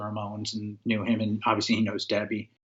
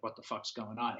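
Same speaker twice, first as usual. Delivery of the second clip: confused inner thought; 12 dB quieter than usual. Retold or imitated. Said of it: "I'm like".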